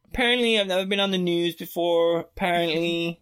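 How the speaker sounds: high, exaggerated mock voice; mocking repetition. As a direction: parody the word apparently over and over. Repeating "apparently" mockingly.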